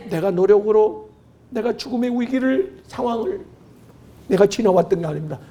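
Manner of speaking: usual speaking voice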